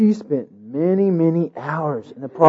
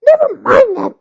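high, sharp interrupting bride; 4 dB louder than usual. A narrator's reading. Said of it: "the bride interrupted".

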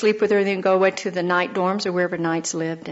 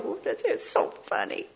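breathy, higher scoffing aside; mocking snicker; 6 semitones higher, 8 dB quieter than usual. Israelites scoffing at Jeremiah, snickering mock whisper. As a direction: sneer at the prophet in a half-laugh whisper.

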